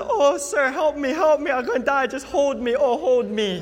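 high, loud, hammed-up cries of fear; mock panicked girl. Girl on turbulent plane begging pastor, mock terror. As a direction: play the frightened girl clutching his arm. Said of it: "she said".

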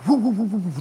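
quick repeated airy whoo; whirling stone noise. Retold or imitated. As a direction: imitate a sling stone spinning through air.

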